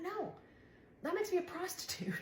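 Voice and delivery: very high-pitched retold protest; scandalized, shooting way up high; indignant refusal. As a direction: squeal the objection in mock outrage. Retold refusal to friend's suggestion.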